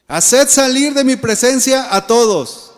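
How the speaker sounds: loud, high, drawn-out command; crying out. A narrator's reading. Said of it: "Y clamó".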